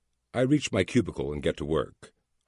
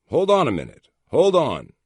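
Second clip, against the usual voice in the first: much higher, louder command to stop; firm interruption; 10 semitones higher, 9 dB louder than usual. A narrator's reading. Boss cutting off a ranting agent, loud and firm. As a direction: cut him off firmly and loudly.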